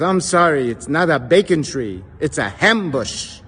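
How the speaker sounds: put-on emphatic old man with Yiddish accent. Old man corrects himself from dictionary, Yiddish accent.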